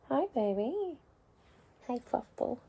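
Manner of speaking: high, soft cooing to the cat; tender sing-song greeting. Real, not acted. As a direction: coo hello to a beloved pet. Greeting her pet.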